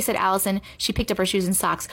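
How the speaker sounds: plain reading voice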